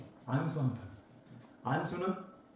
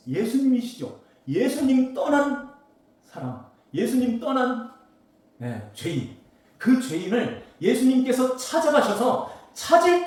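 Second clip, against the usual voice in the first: higher and louder, pressing preaching; fervent preaching; 8 semitones higher, 9 dB louder than usual. Acted. Preacher telling church Jesus seeks the sinner, full-force preaching. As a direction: build to a ringing sermon climax. Preacher explains the lost sheep.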